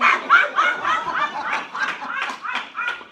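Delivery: very high, loud laugh. Real, not acted. Storyteller to audience, laughing very loudly. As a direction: howl with laughter.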